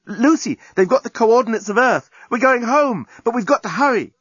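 put-on high, eager young woman's voice urging haste; Nettie, urgent. A narrator's reading. Nettie urging Lucy to rush home, high and urgent. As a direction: raise the pitch and press her to hurry.

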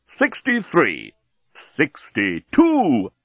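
slow, mechanical countdown voice; talking bomb. Acted. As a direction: count down like a machine. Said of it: "said the bomb".

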